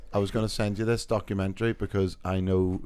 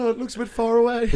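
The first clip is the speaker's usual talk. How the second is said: laughing impression; 12 semitones higher, 4 dB louder than usual. Imitating caller, laughing.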